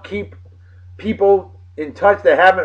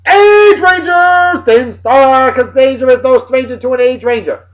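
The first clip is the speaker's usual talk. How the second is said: hyped catchphrase; 8 semitones higher, 5 dB louder than usual. Acted. Catchphrase shout-out to a viewer.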